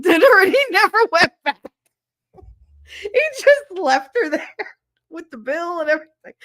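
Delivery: high, loud burst of laughter through the words; laughing so hard the voice squeaks high. Real, not acted. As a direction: crack up while telling it. Laughing about the date story.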